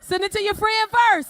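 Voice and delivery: rally the fellas; hammed-up urging. Comic urging the men in the crowd, loud and high. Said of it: "urging the men".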